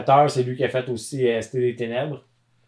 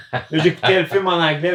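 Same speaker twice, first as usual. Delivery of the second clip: normal talking voice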